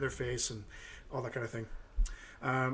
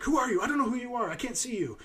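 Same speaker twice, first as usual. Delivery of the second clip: put-on high, frightened old lady voice; a frightened woman behind a locked door; hysterical old lady; 10 semitones higher, faster than usual. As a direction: pitch up like a scared elderly woman. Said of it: "she was very, uh, hysterical".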